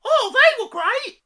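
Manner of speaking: very high, slow, loud sarcasm; sarcastic squeal. Real, not acted. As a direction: squeal mock praise. Sarcastic about crossbows.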